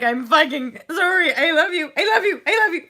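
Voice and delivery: loud, rising, crying I love you; tearful reassurance. Acted. Comforting her inner child, loud and tearful. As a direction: pour love over the hurt child.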